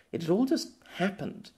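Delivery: ordinary delivery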